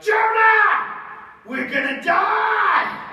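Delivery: high, loud panicked yell, slow on die; terrified sailors yelling at Jonah; panicked shout. Acted. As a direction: scream it like the ship is sinking. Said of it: "And they were like".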